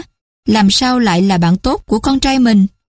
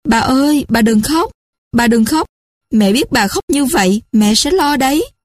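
higher-pitched, mildly alarmed pleading; frightened cry; 5 semitones higher than usual. A narrator's reading. Grandchild begging weeping grandmother not to cry, mildly frightened. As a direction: plead with a touch of fear, raised pitch. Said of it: "sợ hãi kêu lên".

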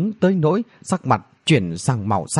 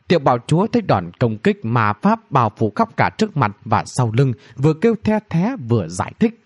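ordinary delivery

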